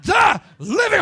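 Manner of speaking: high, loud, slow, hammered-out mom rant; loud, high, slow mock yell of a furious mother. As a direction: scream it like an enraged mother in a Kmart. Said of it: "She was just".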